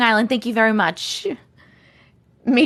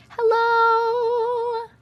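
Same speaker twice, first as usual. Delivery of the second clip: very high, long-held hello; lilting, stretched welcome; singsong greeting; 12 semitones higher than usual. Real, not acted. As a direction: sing out the hello on one high note. Greeting a viewer joining the stream.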